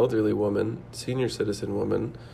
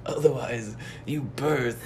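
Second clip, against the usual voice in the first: a lightly voiced old man giving a solemn warning, slowing at the end; sausage-lore elder warns not to sit on frozen corn dogs, mock solemn; mock old sage's warning; 4 semitones higher than usual. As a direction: play it as a mock ancient caution, half-laughing. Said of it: "the old Chinese guy says".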